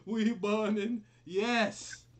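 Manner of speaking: high, loud celebration; celebrating. Real, not acted. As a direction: hype it up loudly. Celebrating loudly.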